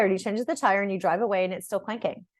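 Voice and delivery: ordinary talk, nothing marked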